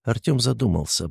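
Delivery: ordinary delivery, nothing marked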